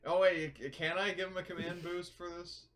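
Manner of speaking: ordinary talk, nothing marked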